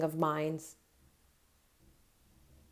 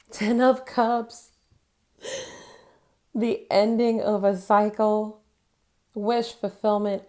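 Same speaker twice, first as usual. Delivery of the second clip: higher, slow and wondering over the card; awed excitement; 4 semitones higher, 3 dB louder, slower than usual. Real, not acted. Reader marvels at the Ten of Cups, slow and awed. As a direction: savour the card with delight.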